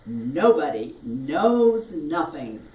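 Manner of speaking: "Nobody knows nothing" slowly drawn out; jaw-opening drill. Shown as a demonstration. Teacher demonstrating a jaw exercise, "Nobody knows nothing" slow and stretched. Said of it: "for the jaw opening".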